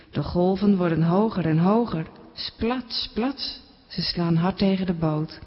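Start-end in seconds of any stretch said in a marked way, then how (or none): 2.3-2.8 softly voiced splat with a rising end; a quiet wave splashing on the boat; soft splash sound. A narrator's reading. Storyteller imitates splashing waves. 2.9-3.6 whispered splat, soft and rising; whispered splash. A narrator's reading. Storyteller imitates splashing waves.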